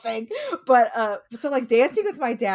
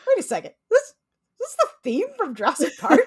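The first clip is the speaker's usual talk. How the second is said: high-pitched, halting disbelief; dawning realization; 8 semitones higher than usual. Acted. Wedding guests slowly recognizing the song, high-pitched dawning surprise. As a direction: puzzle it out haltingly, ending in an amused question. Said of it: "kinda slowly realize that they know this song".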